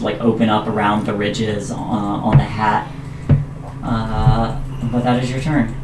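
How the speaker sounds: normal talking voice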